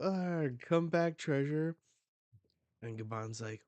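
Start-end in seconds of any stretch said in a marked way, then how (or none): 0.0-1.8 high, loud wail after the loot; loud high-pitched whine; whining pirates. Retold or imitated. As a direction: cry out as the treasure flies off. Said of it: "all these pirates are like".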